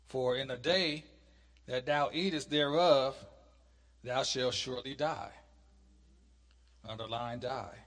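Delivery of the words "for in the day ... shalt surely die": loud, measured Bible reading; scripture reading. Acted. Teacher reads God's command from Genesis to class, scripture reading.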